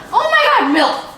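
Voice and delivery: very high, loud excited burst; thrilled squeal. Pretend. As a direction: squeal it like a wonderful discovery. Thrilled at seeing the milk.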